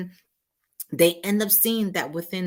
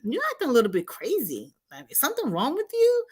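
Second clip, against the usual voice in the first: higher and fast, mocking put-down; dismissive narcissist; 8 semitones higher, faster than usual. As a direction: toss off the insults like the partner is being silly. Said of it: "start to call them names".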